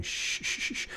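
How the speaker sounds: breathy hushing shh; shushing. Retold or imitated. Lifeguard hushing a flailing swimmer, breathy shush. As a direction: hush a panicking swimmer. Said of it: "like a lifeguard does".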